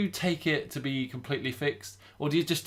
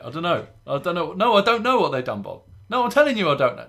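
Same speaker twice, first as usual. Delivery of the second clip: higher, louder voice of a clueless engineer on the phone; flustered engineer; 4 semitones higher, 5 dB louder than usual. Retold or imitated. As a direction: play the helpless repairman begging Bob for help. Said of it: "and they're like".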